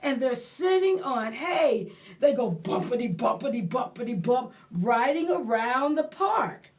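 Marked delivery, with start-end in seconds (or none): none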